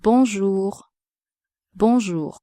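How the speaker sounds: "Bonjour" with nasal on and throaty French r; French bonjour for repetition. Shown as a demonstration. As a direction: model "Bonjour" twice for learners. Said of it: "Repeat after me".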